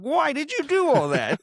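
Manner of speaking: high incredulous cry of why; squeaky mock outrage at the screen; exasperated why. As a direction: throw up your hands in disbelief. Exasperated reaction to movie scene.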